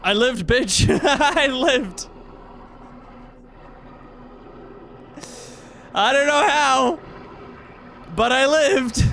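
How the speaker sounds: elated victory shout; triumphant. Real, not acted. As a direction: crow about surviving, half laughing. Celebrates surviving against odds.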